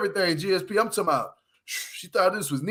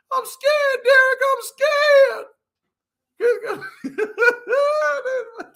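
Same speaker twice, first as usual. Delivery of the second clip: high falsetto mock whimper; hammed-up scared squeal; imitating Monique scared; 4 dB louder than usual. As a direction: whine it like a frightened woman. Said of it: "You better cry for yourself".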